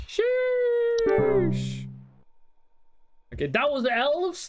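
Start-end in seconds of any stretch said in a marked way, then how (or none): 0.1-1.9 exaggerated sheesh. Real, not acted. Reacting to the Christmas joke.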